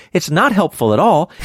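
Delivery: ordinary talk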